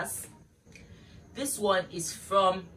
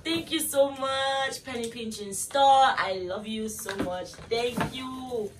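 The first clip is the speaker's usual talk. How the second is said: gushing thanks; 6 semitones higher, 3 dB louder, slower than usual. Real, not acted.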